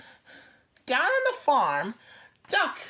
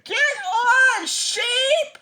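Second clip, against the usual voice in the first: much higher, louder call; shouted; 11 semitones higher, 7 dB louder, slower than usual. A narrator's reading. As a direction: shout at Sheep to hop on. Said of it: "everyone shouted".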